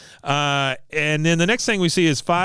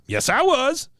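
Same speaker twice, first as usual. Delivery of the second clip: very high, stubborn retort; play-acted protest, pitched right up; mock indignant insistence; 12 semitones higher, slower than usual. Cotton insisting a second time.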